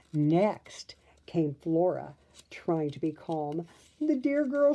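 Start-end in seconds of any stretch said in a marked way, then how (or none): 4.0-4.7 high, flustered fairy voice; fluttering. A narrator's reading. Flora fussing over the princess, high fluttering voice. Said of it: "she fluttered".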